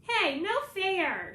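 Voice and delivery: slow, high, loud drawn-out whine. A narrator's reading. Agatha whining at Ben who grabbed the phone, loud and drawn out. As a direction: whine it like a cheated little sister. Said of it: "she whines".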